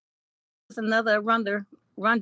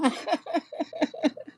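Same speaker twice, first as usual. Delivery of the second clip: giggle; 7 semitones higher, 5 dB quieter than usual. Real, not acted. Laughs at B Carter's tease.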